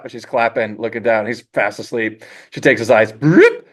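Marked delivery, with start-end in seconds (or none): 3.1-3.7 high, loud cartoon "broop"; vocal sound effect, sudden and high. Storyboard pitch for a gag, cartoon sound effect.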